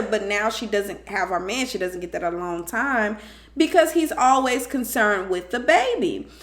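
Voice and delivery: normal talking voice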